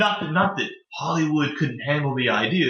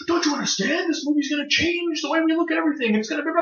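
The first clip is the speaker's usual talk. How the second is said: over-the-top imitated shouting, much higher; mock tantrum scream; 11 semitones higher than usual. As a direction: ham up a director screaming at the band. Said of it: "starts screaming about how".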